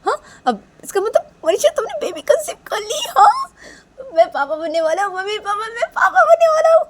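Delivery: very high, loud squeal of joy; overjoyed outburst. A narrator's reading. Nisha learns of Manisha's baby, overjoyed. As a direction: burst out in wild, squealing delight. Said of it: "निशा इधर पे खुश होने लगती है".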